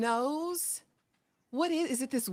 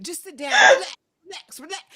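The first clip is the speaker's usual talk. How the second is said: mock order that jumps louder and higher; almost yelling; 7 semitones higher, 13 dB louder than usual. Stern man orders her to sit down, almost yelling. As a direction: imitate a stern man bursting out. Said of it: "he's almost yelling at this point".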